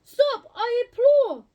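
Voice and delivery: high, loud plea; pleading cry. A narrator's reading. Old lady begs the animals to stop, crying out high and loud. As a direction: beg them to stop. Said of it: "the little old lady cried".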